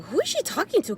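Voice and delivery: high, baffled question; who is she even talking to, high and lost; puzzled. Retold or imitated. Wife asks her husband, high and baffled. Confused reaction to stranger.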